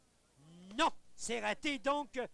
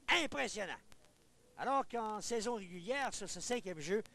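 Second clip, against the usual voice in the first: usual speaking voice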